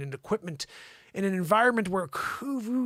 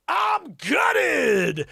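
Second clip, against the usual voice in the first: high, drawn-out theatrical yell; mock-anguished shout; 8 semitones higher, 4 dB louder than usual. Host acting out [name]'s headline quote, mock-anguished shout. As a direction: cry out the quote like a devastated star.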